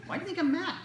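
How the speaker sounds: high-pitched mock bafflement; puzzled parent. Parent to kid who asked, high puzzled protest.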